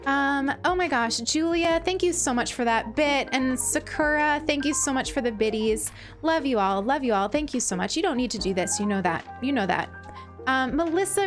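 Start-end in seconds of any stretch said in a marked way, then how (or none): none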